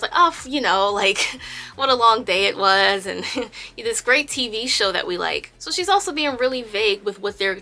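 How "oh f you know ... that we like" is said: halting, laughing cover-up; fumbling for words. Retold or imitated. Yena dodging Tink's question, fumbling her words. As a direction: stumble and giggle through an excuse. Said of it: "fumbling her words".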